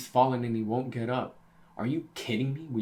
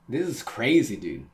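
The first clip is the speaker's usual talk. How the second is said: excited; 7 dB louder than usual. Real, not acted. Reader hyped over the reunion.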